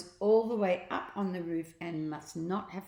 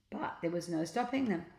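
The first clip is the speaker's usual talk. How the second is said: usual speaking voice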